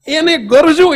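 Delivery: fervent sermon emphasis; emphatic preaching. Real, not acted. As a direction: hammer the word home. Preacher driving home point.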